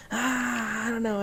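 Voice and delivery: soft, reluctant murmur; hesitant shy reply. Acted. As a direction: play the shy roommate wavering. Acts reluctant shy roommate.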